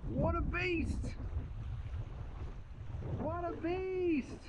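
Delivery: ordinary talk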